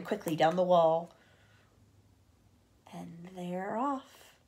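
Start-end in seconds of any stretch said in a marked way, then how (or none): 2.9-4.3 slow, quiet remark; hushed excited aside; soft slow aside. Real, not acted. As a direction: breathe it out gently. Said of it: "reader's quiet closing aside".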